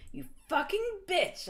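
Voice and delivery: high, slow, hammed-up angry yell; angry shout. A narrator's reading. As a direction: spit it out at her like a mock tantrum. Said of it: "I shouted angrily".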